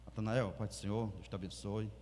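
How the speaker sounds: a muted respectful condolence; hushed greeting. Retold or imitated. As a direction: greet him gently, in funeral quiet. Controlled hushed greeting at funeral.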